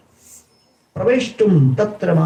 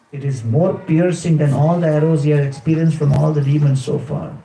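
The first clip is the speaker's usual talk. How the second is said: ordinary talk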